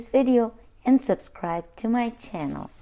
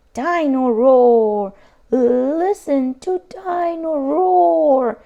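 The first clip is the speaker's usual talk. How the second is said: slow, loud, higher, stretched toy call; toy roar; 5 semitones higher, 6 dB louder, slower than usual. Acted. Toy dinosaur roaring as it runs down, slow loud call. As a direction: drag it out like a winding-down toy.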